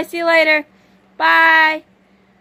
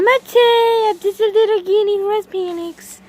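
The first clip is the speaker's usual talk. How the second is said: voicing the pet; 6 semitones higher, 4 dB louder than usual. Voicing the guinea pig.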